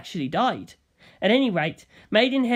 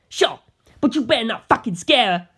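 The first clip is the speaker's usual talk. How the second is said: raised, louder tough-guy warning; a tough young gangster snapping a warning; gruff warning; 5 semitones higher, 5 dB louder than usual. Acted. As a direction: bark it like a protective thug. Narancia warning, voiced.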